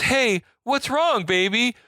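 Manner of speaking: high, slow seductive alien purr. Retold or imitated. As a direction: coo it like a smitten alien. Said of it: "And the alien goes".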